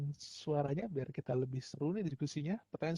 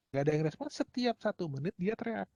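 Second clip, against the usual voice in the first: ordinary talk, nothing marked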